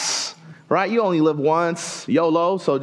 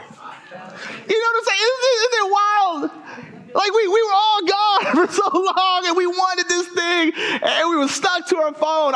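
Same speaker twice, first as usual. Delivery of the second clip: high, strained comic squeal; high-pitched exasperation; 11 semitones higher than usual. Pretend. Preacher marvelling at phone habits after lockdown, high-pitched mock disbelief.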